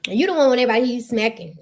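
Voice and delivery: ordinary talk, nothing marked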